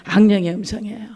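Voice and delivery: hushed whispered answer. Shown as a demonstration. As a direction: drop to a secret whisper.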